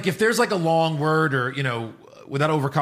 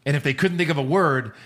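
ordinary talk, nothing marked

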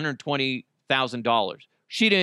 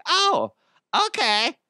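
mild surprise; 11 semitones higher, 3 dB louder, slower than usual. Pretend. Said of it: "they're gonna be like".

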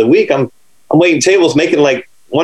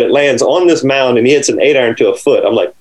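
ordinary delivery

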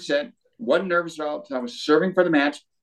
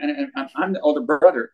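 ordinary talk

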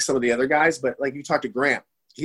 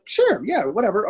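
mock casual; 7 semitones higher, 3 dB louder than usual. Casually agreeing to a photo.

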